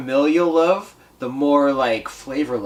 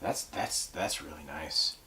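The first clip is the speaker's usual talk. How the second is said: hushed; 8 semitones lower, 12 dB quieter, slower than usual. Real, not acted. Savoring the whiskey, hushed.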